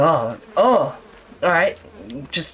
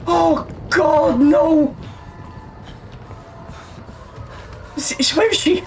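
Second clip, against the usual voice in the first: terrified screaming; 12 semitones higher, slower than usual. Real, not acted. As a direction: shriek in real terror. Chased by the monster.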